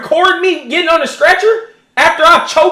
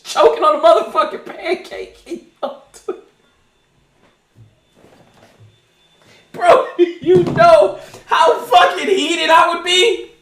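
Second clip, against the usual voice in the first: laughing; 3 semitones higher than usual. Real, not acted.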